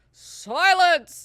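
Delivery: high, very loud drawn-out command; commanding shout. Retold or imitated. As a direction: silence a whole crowd as the preacher. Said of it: "silences everyone with the voice".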